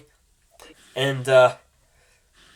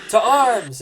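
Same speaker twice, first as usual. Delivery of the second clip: higher, loud rallying call; rally the guards; guard rallying the soldiers; call to arms; 7 semitones higher, 5 dB louder than usual. Acted.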